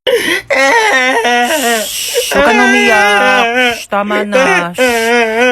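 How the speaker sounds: drawn-out shushing and pleading; exasperated hushing. Acted. Mother telling baby to stop crying, hushing. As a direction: hush over a wailing baby.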